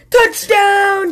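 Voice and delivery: high, drawn-out touchdown cheer. A narrator's reading. Otter after throwing a football past Cat, gleeful high cheer. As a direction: cheer it out like a sports fan. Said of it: "cheered Otter".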